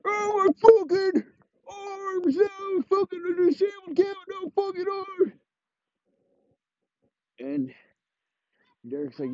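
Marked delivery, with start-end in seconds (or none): 0.0-5.3 put-on very high crying wail of a hurt kid. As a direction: whine high and broken like a hurt child. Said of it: "crashes his wheelchair".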